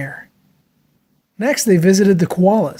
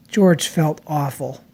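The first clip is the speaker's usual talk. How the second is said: ordinary delivery, nothing marked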